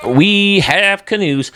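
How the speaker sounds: delighted. Real, not acted. Delighted at canoes in stadium.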